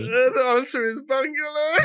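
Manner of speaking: high-pitched, laughing as you give the answer; gleeful gotcha, laughing; laughing reveal. Real, not acted. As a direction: reveal it through real laughter. Laughing while revealing the trick answer.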